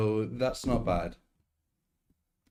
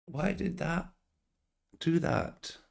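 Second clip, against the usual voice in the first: baffled; 11 semitones higher than usual. Real, not acted. Baffled by the crash.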